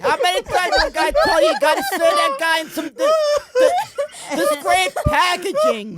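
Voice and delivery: high, laughing mock-angry Boston boss; scolding boss. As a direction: scold the clerk through laughter. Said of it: "doing the guys from Wicked High".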